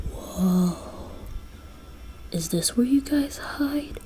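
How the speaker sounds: soft, wondering near-whisper; hushed awe. Acted. Listener seeing the Northern Lights at the North Pole, hushed awe. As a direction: breathe it out in quiet amazement.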